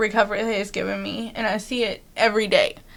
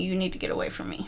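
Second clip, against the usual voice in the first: fast, hushed whisper of a warning; 2 semitones lower, 10 dB quieter, faster than usual. Retold or imitated. To a mean coworker, a fast whispered warning. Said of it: "Nice. Very diplomatic".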